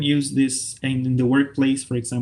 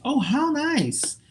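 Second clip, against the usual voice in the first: "how nice" swooping very high, slowly drawn out; expressive intonation; 12 semitones higher, much slower than usual. Shown as a demonstration. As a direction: gush "how nice" with a long, high, falling tune. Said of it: "The intonation, if it's a phrase".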